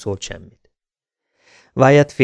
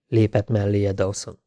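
ordinary delivery

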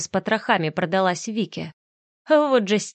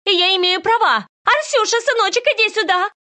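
much higher, louder shrill call; hysterical calling; 13 semitones higher, 5 dB louder than usual. A narrator's reading. Вика calls Арсюша hysterically to come to her. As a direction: screech for the boy.

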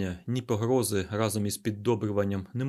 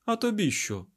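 high-pitched brusque question; sharp retort; 11 semitones higher, 3 dB louder than usual. A narrator's reading. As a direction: snap back at the intruder. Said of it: "різко кинув Айзек".

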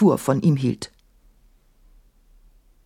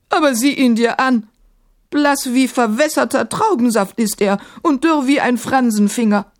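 put-on higher-pitched old grandmother, dry retort; raised-pitch matriarch voice; critical grandmother; 8 semitones higher, 4 dB louder than usual. A narrator's reading. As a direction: play a critical grandmother cutting in. Grandmother mocks the djinn.

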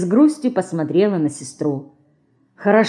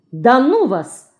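annoyed; 6 semitones higher, slower than usual. A narrator's reading. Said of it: "рассердилась Марина".

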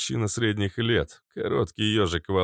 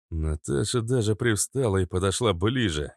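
ordinary delivery, nothing marked

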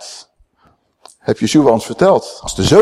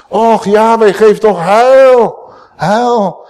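higher and louder, slowly drawn out; fervent prayer; 7 semitones higher, 5 dB louder, much slower than usual. Acted. The preacher repeats the psalm's plea to God, high and slow. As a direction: lift the words up to God.

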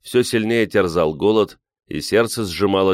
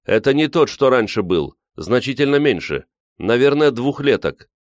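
eager, higher-pitched chatter; joyful report; 6 semitones higher, 3 dB louder than usual. A narrator's reading. Grisha at the window describing the bear, joyful. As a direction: share the news with lively relief. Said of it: "радостно доложил Гриша".

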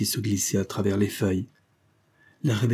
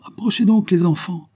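high, louder summons; calling out; 10 semitones higher, 6 dB louder than usual. A narrator's reading. Representative summons the frightened girls closer, calling out. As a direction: call them over with a lifted voice. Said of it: "leur cria le représentant".

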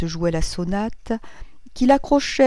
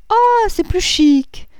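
high, loud, drawn-out mock amazement; mocking exclamation; 14 semitones higher, 8 dB louder, slower than usual. A narrator's reading. Madame Verdurin mocks the doctor's phrase. (exclamation moqueuse, aiguë et traînante)

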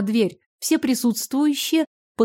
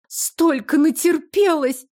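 sobbing; 6 semitones higher, slower than usual. A narrator's reading. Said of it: "всхлипнула Алка".

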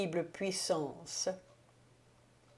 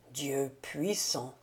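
slow, soft, lower awed murmur; 5 semitones lower, 5 dB quieter, slower than usual. A narrator's reading. Awestruck count murmurs seeing his dead wife. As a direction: breathe it out in dread. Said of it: "murmura le comte".

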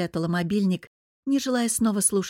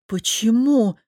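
loud indignant question; affronted outburst on one word; 3 semitones higher, 6 dB louder, slower than usual. A narrator's reading. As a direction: protest with a louder, stretched why. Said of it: "возмутилась я".

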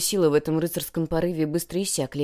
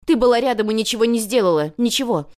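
raised, louder reproach; accusing; 5 semitones higher, 7 dB louder than usual. A narrator's reading. Girl blames her rival for not helping, accusing. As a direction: drive the blame home.

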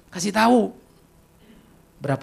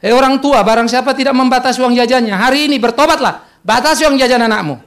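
loud, raised preacher's call; preaching pastor; 5 semitones higher, 7 dB louder than usual. Retold or imitated. Pastor mock-preaching to parents, loud and raised. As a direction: thunder at the parents. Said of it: "Pak Pendeta bilang".

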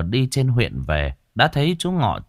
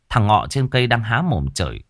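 usual speaking voice

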